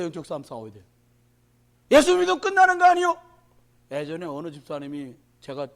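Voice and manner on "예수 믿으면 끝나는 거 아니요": preaching at full force. Acted. Pastor warning the congregation about the times, loud and high.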